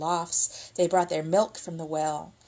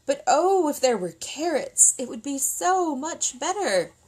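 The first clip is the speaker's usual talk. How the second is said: high, coaxing sigh of longing; wistful soldier; 7 semitones higher than usual. Acted. Soldier hinting to villagers for carrots, wistful and high. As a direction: dangle the wish before the peasants.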